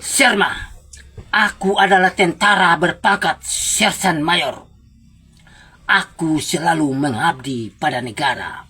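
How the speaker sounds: hammy stage actor reciting poetry; declamation. Shown as a demonstration. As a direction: declaim it grandly like a patriotic poem. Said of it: "memberikan sebuah deklamasi pendek". (deklamasi penuh semangat ala panggung)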